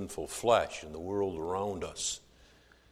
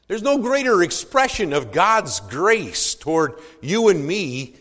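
higher, louder, very slow pulpit cry; louder second reading of the verse; emphatic preaching; 7 semitones higher, 11 dB louder than usual. Acted. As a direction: build the verse to a ringing peak.

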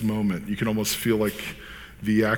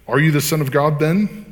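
raised, louder interrogation; challenging question; 5 semitones higher, 7 dB louder than usual. A narrator's reading. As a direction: press him for an answer, pitched up. Council interrogates Jesus in scripture.

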